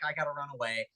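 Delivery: ordinary talk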